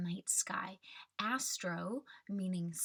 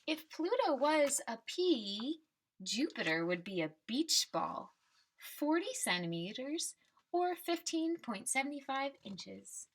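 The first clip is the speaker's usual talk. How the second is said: put-on reading to children. Teacher reading the class a picture book, storybook voice. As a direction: read the refrain like a picture book for kids.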